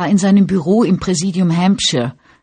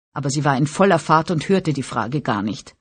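plain reading voice

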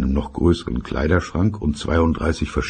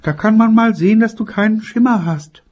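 grumbling mother; 6 dB louder than usual. Acted. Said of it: "und das hieß und heißt es immer noch".

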